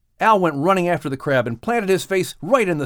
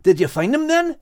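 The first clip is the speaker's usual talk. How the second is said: higher, keen question; eager question; 8 semitones higher than usual. A narrator's reading. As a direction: ask it hungry for the news. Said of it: "asked Max eagerly".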